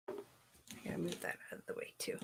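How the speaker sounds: fast whispered aside. Real, not acted. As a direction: mutter it under your breath, quickly. Muttered aside while working.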